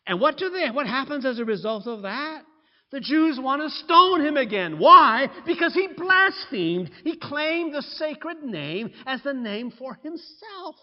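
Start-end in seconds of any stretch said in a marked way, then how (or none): 2.9-6.9 loud, high, rising fervor; thunderous pulpit outcry; preaching climax. Real, not acted. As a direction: drive it home with full force.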